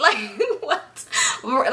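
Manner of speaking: words broken by laughter; laughing through the question. Real, not acted. Cracking up at the driver's answer, laughing. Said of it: "we're dying".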